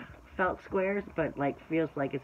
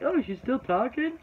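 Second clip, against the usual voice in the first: higher-pitched mock complaint from a viewer; impatient viewers; 8 semitones higher than usual. Imagined viewers fast forwarding past her rant, mock incredulous. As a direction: imitate a bored viewer, pitched up in disbelief. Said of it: "They'll be like".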